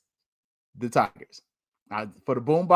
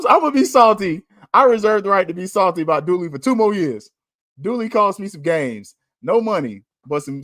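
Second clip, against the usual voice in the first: ordinary talk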